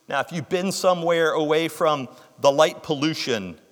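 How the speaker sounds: ordinary delivery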